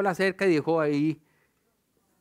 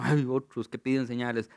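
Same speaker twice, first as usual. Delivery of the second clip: sighing, lower and tired; weary sigh; 6 semitones lower than usual. A narrator's reading. Jesus sighing about the Pharisees who ask for signs, weary. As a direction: let it out on a sigh. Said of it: "suspiró y dijo".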